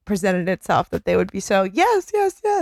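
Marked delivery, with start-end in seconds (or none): 1.6-2.6 higher, eager repeated yes. Retold or imitated. Norma eagerly accepting Ed, gushing. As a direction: gush yes, yes.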